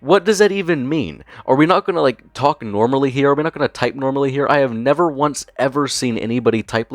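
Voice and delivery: high-pitched incredulous rant; worked-up, bewildered outburst; exasperated disbelief. Real, not acted. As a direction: pile up baffled questions, voice shooting up. Ranting in disbelief at the messages.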